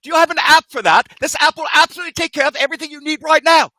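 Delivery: high, loud, fast salesman hype; infomercial pitchman. Acted. Host mocking TV ads in a high, fast pitchman voice. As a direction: sell it like a late-night TV pitchman.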